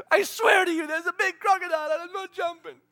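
high, loud frightened protest; panicked refusal. Retold or imitated. Frightened believer refusing to jump from the boat, loud protest. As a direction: plead loudly like someone terrified.

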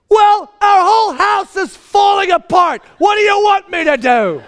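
high, furious full-force yell; top-of-lungs shout. Retold or imitated. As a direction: scream in desperate exasperation at your wife. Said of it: "I shout at the top of my lungs".

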